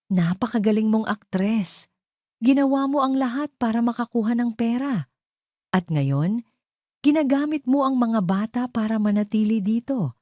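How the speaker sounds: furious accusation. Acted. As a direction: tear into her for the betrayal. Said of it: "matinding galit at pagtataksil".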